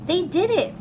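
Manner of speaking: joyful shout of victory; excited. A narrator's reading. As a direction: celebrate the win for the kids. Story climax, winning first place.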